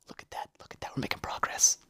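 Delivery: whispered look at that; soft whisper. Real, not acted. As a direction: hush it as a private aside. Whispered aside.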